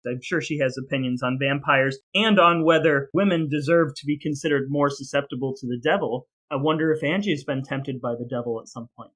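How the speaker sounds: ordinary delivery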